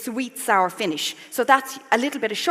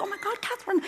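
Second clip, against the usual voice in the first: high, softer gasp of amazement; impressed relatives; 10 semitones higher, 9 dB quieter, faster than usual. Retold or imitated. Sicilian relatives to their cooking in-law, hushed high amazement. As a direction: mimic awed in-laws, pitched way up. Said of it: "they were so impressed".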